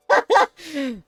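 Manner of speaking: very high giggle; high laugh. Real, not acted. Laughs after bit.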